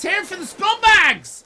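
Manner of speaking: high loud rallying shout; rallying cheer. Real, not acted. Streamer hyping his chat, rallying shout. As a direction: whip the crowd up. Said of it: "hyping the chat".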